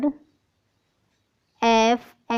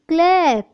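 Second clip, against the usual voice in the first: Clap said higher and louder as the answer; blended word announced; 6 semitones higher, 4 dB louder than usual. Shown as a demonstration. Teacher announcing the blended word to students. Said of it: "C L A P".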